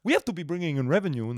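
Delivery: hammed-up French-Canadian tycoon talking business; Mario impression. Comedian doing his French-Canadian club owner for a mate. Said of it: "I've been impersonating the new owner".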